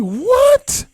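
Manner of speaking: very high, loud mock "what?"; outraged disbelief. Rapper hearing he's like [name], incredulous. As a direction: squawk it as a shocked question.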